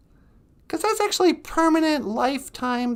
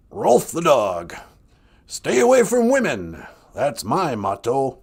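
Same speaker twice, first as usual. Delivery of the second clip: Rolf the dog; 13 semitones lower than usual. Acted. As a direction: drop way down into a gruff cartoon dog. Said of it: "I can do a, uh, Rolf the dog voice".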